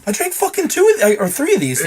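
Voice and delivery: high, loud disbelieving protest; worked-up, incredulous outburst; retold outrage. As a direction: protest loudly, pitch shooting up. Retold outrage at spiked drinks.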